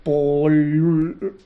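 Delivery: "pol-" with the L held long; accented L demo. Shown as a demonstration. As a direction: drag out the final L of "pol-", stopping mid-word. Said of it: "cómo se dice".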